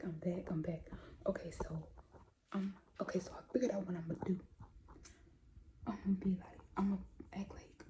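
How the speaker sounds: hushed whisper so nobody hears; whisper, hiding in the next room. Real, not acted. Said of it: "I'm whispering right now because she is right next to my room".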